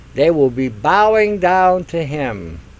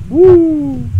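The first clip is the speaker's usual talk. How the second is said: very high squeal then loud woo; high giddy shriek, louder; falsetto mock whoop; 11 semitones higher, 8 dB louder than usual.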